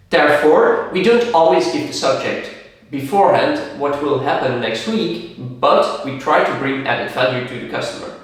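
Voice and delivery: ordinary talk, nothing marked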